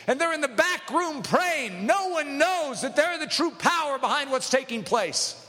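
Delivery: high, urgent pulpit delivery; impassioned preaching. Real, not acted. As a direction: press it on the congregation with fervour. Preaches at full force.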